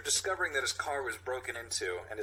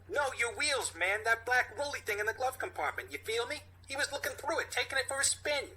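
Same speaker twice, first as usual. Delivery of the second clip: put-on high New York street kid; New York accent; 12 semitones higher than usual. Same kid character voice.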